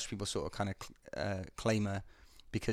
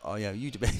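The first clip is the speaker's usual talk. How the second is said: ordinary delivery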